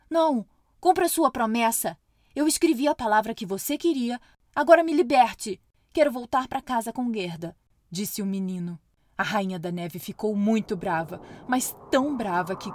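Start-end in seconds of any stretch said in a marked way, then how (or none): 0.1-7.6 put-on boy's demand. Kai demands freedom from queen.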